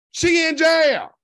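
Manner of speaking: loud, slow, emphatic. Real, not acted. Repeating news with emphasis.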